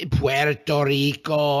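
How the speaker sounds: Spanish-style "Puerto Rico", slow, rolled and clipped like "Pwerto Riko"; mock Spanish accent. Mocking how the president says it, exaggerated Spanish accent. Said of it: "He kept saying Puerto Rico in this weird".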